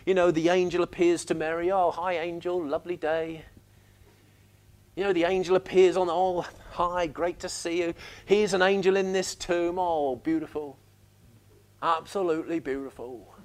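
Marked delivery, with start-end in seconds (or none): 1.7-3.5 mock calm. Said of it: "a calm demeanor of reading". 6.1-13.3 mock understated. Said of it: "reactions are sort of very understated".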